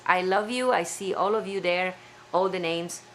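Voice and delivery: normal talking voice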